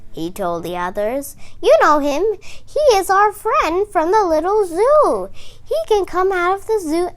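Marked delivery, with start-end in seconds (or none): 1.6-7.1 high, loud cheerful explaining; happy frog. Acted. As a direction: happily reassure the others.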